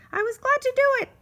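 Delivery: pleased mosquito. Acted. Mosquito answers Grasshopper's thanks, high and pleased. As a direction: chirp it out proudly.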